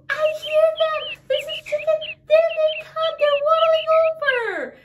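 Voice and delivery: high, loud and thrilled; excited. Acted. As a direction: burst with delight at finding them. Chicklets found, announcing to hen.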